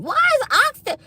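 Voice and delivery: high, shocked outcry over the price; incredulous squeal. Real, not acted. Complaining to viewers about oxtail prices, high shocked outcry. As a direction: cry out in disbelief.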